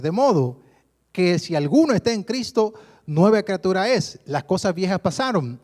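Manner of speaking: loud, proclaiming Bible reading; preaching at full force; scripture reading. Acted. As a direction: read it out like a preacher.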